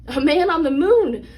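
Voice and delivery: a thrilled storyteller's cry; excited exclamation. A narrator's reading. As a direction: exclaim it with awe and delight. Narrator exclaims wonder.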